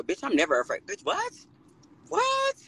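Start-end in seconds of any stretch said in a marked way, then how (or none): 0.9-1.3 high, incredulous what. Real, not acted. Scoffing at the rumour she is afraid, a high incredulous what. 2.1-2.6 squeaking the what even higher; higher incredulous what. Real, not acted. Scoffing at the rumour she is afraid, a squeaking what. Repeats in disbelief.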